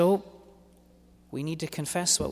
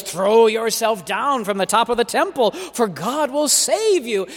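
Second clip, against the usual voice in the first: higher, louder devil taunt, slowing at the end; 7 semitones higher, 7 dB louder than usual. Acted. Preacher voicing the devil daring Jesus, loud taunting. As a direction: goad him to jump.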